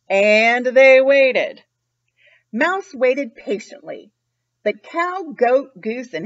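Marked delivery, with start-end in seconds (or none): 0.1-1.6 very slow and louder still; very drawn out. A narrator's reading. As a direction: stretch it right out, the longest wait. Repeated phrase, slowest and loudest.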